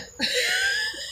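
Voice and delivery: high, quiet wheezy giggle; soft giggle at your own joke; light high chuckle; laugh. Real, not acted.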